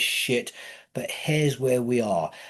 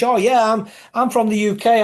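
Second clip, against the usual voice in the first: higher, louder smug mimicry of the English; mocking smug English; 7 semitones higher, 4 dB louder than usual. Mocking the English who boast they're from the UK, smug higher mimicry. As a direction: lampoon a proud Englishman boasting. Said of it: "But the English".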